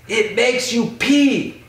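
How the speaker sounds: very high, drawn-out mock exasperation; imitated scolding. Max explaining what the water does, mock scolding. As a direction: stretch it out high like an appalled friend.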